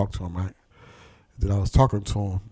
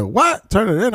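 high-pitched retold disbelief; incredulous; 11 semitones higher than usual. Detroit man asked about turning guns in, incredulous. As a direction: echo the question back as if it were absurd.

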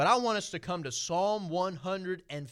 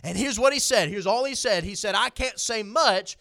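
raised, driving sermon voice; preaching at full force; 6 semitones higher, 7 dB louder than usual. Acted. Preacher to his church, rising in fervour. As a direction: lift the congregation, preach it out.

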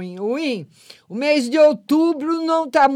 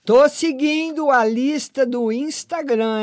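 ordinary delivery

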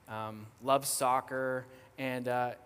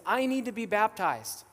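higher, louder retold insistence; imitating an emphatic, eager convert; urgent declaration; 7 semitones higher, 5 dB louder than usual. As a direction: declare it with sudden conviction. Retold convert declares her wish.

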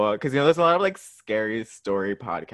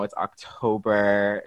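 ordinary talk

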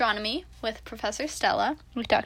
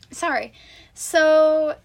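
ordinary delivery

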